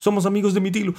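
high, loud dog voice; bright-eyed pup piping up loudly; eager dog. A narrator's reading. Dog introducing himself to the cormorant, high and eager. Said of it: "dijo el perro".

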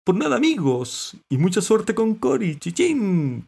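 high, loud playful send-off; lively high-pitched character voice; lightly laughing Santi. A narrator's reading. Santi wishing friends luck with Cory, playfully high. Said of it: "dijo Santi entre risas".

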